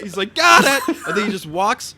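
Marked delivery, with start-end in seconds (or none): 0.3-1.0 loud, high victory scream. Retold or imitated. Stranger after popping the balloon, loud triumphant scream. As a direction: scream it like a trophy win. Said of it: "He just screams".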